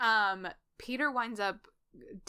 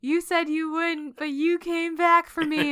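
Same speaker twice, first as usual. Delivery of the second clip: higher, louder, slow words laughed out with delight; joyful laughing; 8 semitones higher, 10 dB louder, slower than usual. Retold or imitated. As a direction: laugh through the happy relief. Peter overjoyed after rescue.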